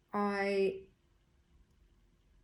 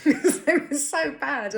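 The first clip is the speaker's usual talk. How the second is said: laughing; 8 semitones higher, 10 dB louder than usual. Real, not acted. Laughing at awful counsellors.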